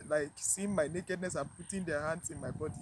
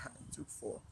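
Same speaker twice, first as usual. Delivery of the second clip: whispered to herself, almost to nothing; 3 semitones lower, 10 dB quieter than usual. Real, not acted. Remembering the four hot drips, whispered in disbelief. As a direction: breathe the words out with no voice. Repeats the line, then whispers it.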